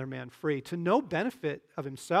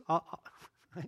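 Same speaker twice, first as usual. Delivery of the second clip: ordinary talk, nothing marked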